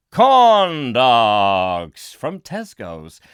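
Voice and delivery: loud, hugely drawn-out reveal; grand announcement. Pretend. Presenter unveiling a gift, grand announcement. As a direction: boom it out and stretch each word.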